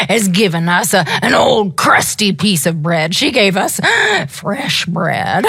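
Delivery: mild mock grumbling dog; a hint of a dog's voice. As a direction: give the dogs a put-on voice. Dogs voiced in story.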